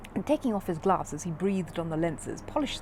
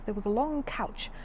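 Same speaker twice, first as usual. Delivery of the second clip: ordinary delivery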